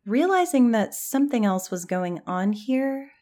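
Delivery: ordinary delivery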